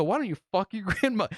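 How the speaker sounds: high retort broken by a laugh; playful retold yell; mock angry comeback. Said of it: "we scream at each other".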